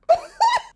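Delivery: shrieking laugh way up high; high squealing laugh. Real, not acted. Vlogger laughing at the cheesy book line, high squeal.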